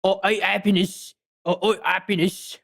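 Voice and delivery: Cockney with the H's dropped; Cockney accent. Shown as a demonstration. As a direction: repeat it in Cockney, no H sounds. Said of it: "say it in a Cockney accent".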